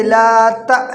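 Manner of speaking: long لا, then تا cut by a glottal stop; ta with hamza. Shown as a demonstration. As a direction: stretch لا and stop sharply on تا. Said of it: "تا امزہ زبر تا". (تا پر ہمزہ کا جھٹکا)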